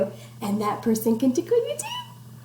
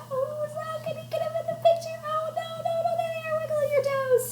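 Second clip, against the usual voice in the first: squeaky toes voice; faster than usual. Acted. Teacher voicing toes on camera, high squeaky tiny voice. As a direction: squeak like little wiggling toes.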